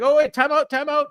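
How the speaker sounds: high, loud, frantic imitation of MJF; mock heel champ. Imitating MJF cutting off Brody's music, high and loud. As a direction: squeal it like a bratty champ. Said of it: "M J F's like".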